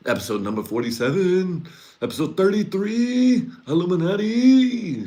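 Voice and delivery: high, loud mock TV announcer, slowing on Illuminati; hammed-up announcer. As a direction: hype a fake episode title. Mock TV show episode announce.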